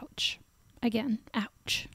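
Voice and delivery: soft whispered wince at the news; whispered ouch. Real, not acted. Podcast host whispering sympathy to Steph, hushed. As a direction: breathe it out in a hush, sympathetic. Reacting to the dinner texting.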